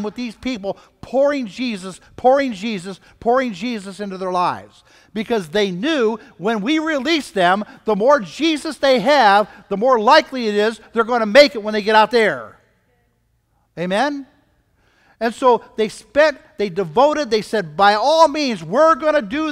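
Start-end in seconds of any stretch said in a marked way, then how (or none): none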